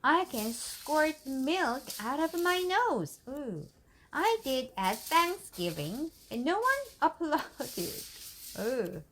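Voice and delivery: plain reading voice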